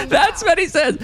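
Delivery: said through a high laugh. Real, not acted. Host still laughing at the streamer, laughing words.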